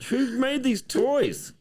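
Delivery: very high, slow squeal of disbelief; hammed-up shrill complaint; mock outrage. Real, not acted. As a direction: wail at the toy makers. Toy falls apart on camera.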